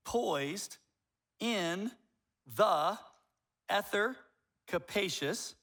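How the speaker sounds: each word set apart with a pause. Shown as a demonstration. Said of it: "I'll even say it slower".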